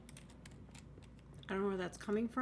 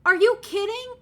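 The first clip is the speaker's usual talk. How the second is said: disbelief; 11 semitones higher, 11 dB louder than usual. Real, not acted. Finds a house with a well.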